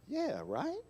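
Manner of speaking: soft, high "Yeah, right" rising at the end; questioning inflection. Shown as a demonstration. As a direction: ask "Yeah, right" quietly, RIGHT lifting up. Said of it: "it's the inflection in my voice".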